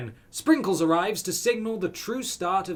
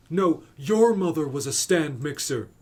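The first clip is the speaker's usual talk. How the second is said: loud; 6 dB louder than usual. Acted. Comeback insult.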